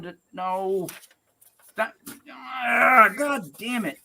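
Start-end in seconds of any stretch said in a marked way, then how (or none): 1.8-4.0 exasperated groan into a curse; fed-up, frustrated outburst; frustrated growl. Real, not acted. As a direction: break off groaning, then curse under it. Botches the read, exasperated outburst.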